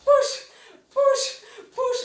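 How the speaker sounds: loud, high single-word bursts; mock over-forced pushing. Dance teacher parodying the wrong way to accent, loud forced bursts. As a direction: fire out each push separately with exaggerated effort. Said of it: "like this. Like, push more from".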